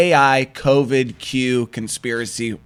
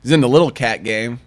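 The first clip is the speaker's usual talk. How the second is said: normal talking voice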